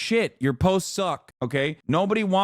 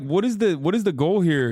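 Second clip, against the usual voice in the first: ordinary delivery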